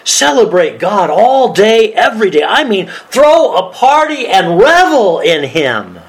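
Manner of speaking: high, slow, celebratory proclamation; exuberant. Acted. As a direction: proclaim it as a call to party. Exuberant reading of celebration verse.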